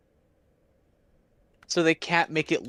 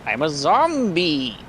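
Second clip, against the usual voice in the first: Minecraft zombie; 3 semitones higher, 4 dB louder, slower than usual. Pretend. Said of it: "What an impression of a Minecraft zombie".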